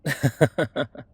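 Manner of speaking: quick run of chuckles; amused laugh. Acted. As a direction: laugh out loud briefly. Amused laugh after compliment.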